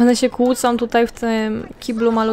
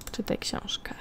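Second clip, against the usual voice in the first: whisper; 13 dB quieter than usual. Real, not acted. Whispering an order to a Sim.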